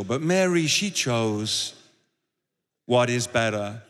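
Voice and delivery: low, slow, overly holy sermon voice; mock spiritual preacher. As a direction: drag out "she chose" like a pious preacher parodying himself. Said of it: "You gotta say it like that to make it sound really spiritual".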